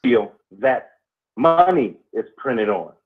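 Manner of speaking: slow, deliberate oration with long pauses; spoken-word pondering. Acted. Co-host expounds on history and time, slow deliberate oration. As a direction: weigh each phrase, pausing heavily between them. Improvised philosophical monologue on history.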